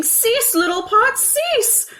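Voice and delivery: very high, loud panicked scream. A narrator's reading. Mother running down the street screaming at the pot. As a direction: shriek at the pot.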